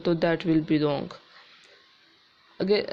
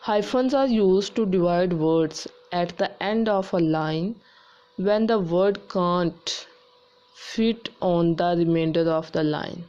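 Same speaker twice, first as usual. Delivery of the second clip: slow dictation reading; much slower than usual. Shown as a demonstration. Teacher reading a grammar rule aloud, slow dictation. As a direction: read the rule out slowly and evenly, like dictation.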